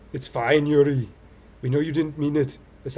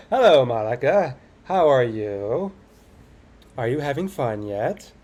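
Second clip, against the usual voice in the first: slow, loud, drawn-out menacing hello; creepy slow greeting; 8 dB louder, slower than usual. Acted. Player greeting Monica, slow and menacing. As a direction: drag it out like a horror villain.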